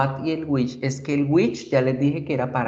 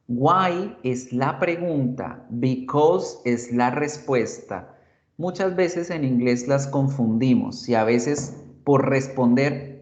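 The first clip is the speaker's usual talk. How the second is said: ordinary talk, nothing marked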